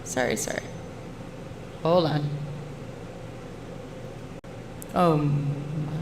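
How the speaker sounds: echoing like in a cave. Real, not acted. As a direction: voice bouncing off cave walls. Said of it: "sounds like you're in a cave".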